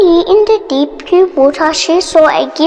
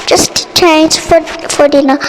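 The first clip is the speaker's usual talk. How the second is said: ordinary delivery, nothing marked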